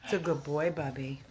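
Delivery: quiet loving words to a sick dog; gentle praise. Real, not acted.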